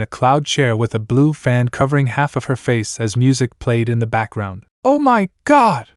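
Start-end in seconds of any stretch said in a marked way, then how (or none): 4.8-5.9 much higher, slow, stunned exclamation; awed gasp. Acted. Ponyhead sees Millie Sparkles appear, gasping in awe. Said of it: "Ponyhead gasped".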